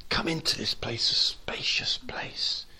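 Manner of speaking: soft, whispered invitation; gentle wooing. Acted. God wooing us into a spacious place, soft whisper. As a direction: coax them in tenderly. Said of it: "He's been wooing, saying".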